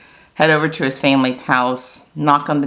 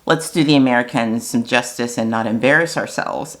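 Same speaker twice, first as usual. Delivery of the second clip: normal talking voice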